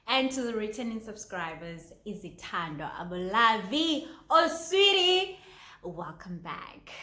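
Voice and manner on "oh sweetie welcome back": tender greeting. Real, not acted. Greeting returning subscriber fondly.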